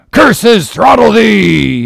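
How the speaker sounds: high, loud, drawn-out curse; angry yell. A narrator's reading. Ahab cursing Gabriel, high furious yell. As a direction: yell the curse with full force. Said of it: "yelled Ahab".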